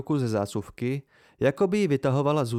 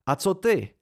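short, raised, angry retort; angry bark; 7 semitones higher, 4 dB louder than usual. A narrator's reading. Narrator turning on Devi who bound him, angry bark. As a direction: throw it back angrily, pitch jumping up. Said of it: "vyštěkl jsem hněvivě".

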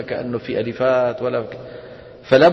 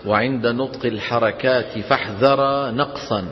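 slow, lower measured recitation; verse reading; 5 semitones lower than usual. Real, not acted. Teacher reads the rule in verse, measured recitation. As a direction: read the verse out slowly and low.

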